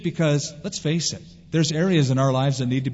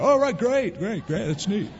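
higher, airy oh right great; distracted agreement; 6 semitones higher than usual. Friend ignoring news of a car accident, hollow mock cheer. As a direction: toss it off without listening. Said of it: "You didn't hear what I just said?".

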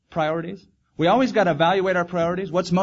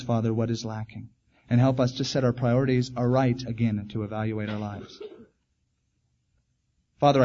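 closing prayer; 4 semitones lower than usual. Acted. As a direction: pray earnestly for the congregation.

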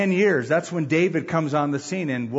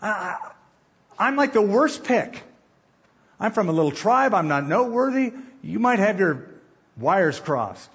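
humble Saul; 5 semitones higher than usual. Retold or imitated. Saul objecting to Samuel that he is too small, flustered. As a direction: plead like a nervous young man picked out. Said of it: "He's going".

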